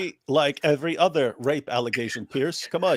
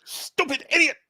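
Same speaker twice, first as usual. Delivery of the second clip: high, hammed-up scolding of a stutterer; mock angry Hijab; 12 semitones higher, 3 dB louder than usual. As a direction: shriek it like a furious mock debater. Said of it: "Hijab's like this".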